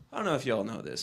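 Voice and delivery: usual speaking voice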